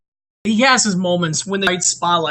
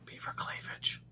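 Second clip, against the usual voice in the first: very soft whispered aside; 23 dB quieter than usual. Pretend. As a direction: slip it in under your breath as a teaser. Whispered teaser aside after segment intro.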